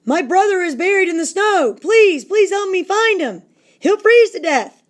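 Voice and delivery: high, loud frantic begging; panicked cry for help; desperate pleading. Acted. Wong begs for help.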